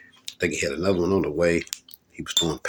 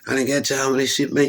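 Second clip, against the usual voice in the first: imitating mad manager; 6 semitones higher than usual. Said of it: "He got mad".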